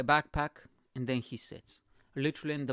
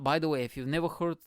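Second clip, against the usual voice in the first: usual speaking voice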